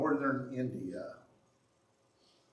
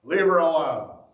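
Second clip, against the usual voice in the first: louder, higher stern big brother command; a firm big brother telling them to stop; 4 semitones higher, 9 dB louder than usual. Acted. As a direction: shut them down like an older brother. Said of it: "his big brother voice".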